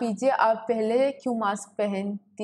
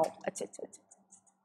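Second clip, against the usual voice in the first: whispered to herself while adjusting the camera; 15 dB quieter, much faster than usual. Real, not acted. Fixing the camera angle, she whispers to herself. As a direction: breathe the words under her breath.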